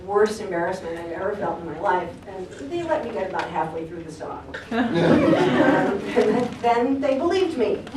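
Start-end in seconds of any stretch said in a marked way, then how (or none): 4.6-8.0 laughing. Real, not acted. Punchline of own story.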